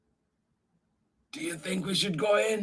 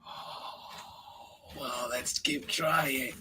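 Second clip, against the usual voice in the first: soft whisper; 4 semitones lower, 10 dB quieter, slower than usual. Acted. Quietly urging kids.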